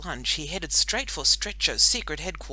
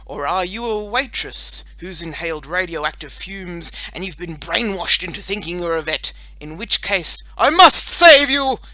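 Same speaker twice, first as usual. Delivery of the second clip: superhero deduction; 2 semitones higher, 10 dB louder than usual. A narrator's reading. Boy superhero decides girl needs saving, building to heroic. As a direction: reason it out, then burst into heroics. Superhero reasons, then vows rescue.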